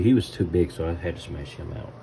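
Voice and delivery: usual speaking voice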